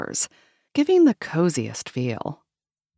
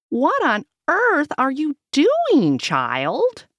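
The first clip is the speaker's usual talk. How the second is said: high, loud baffled question; lightly voiced old lady; puzzled grandma; 10 semitones higher, 3 dB louder, slower than usual. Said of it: "Grandma Louise asks".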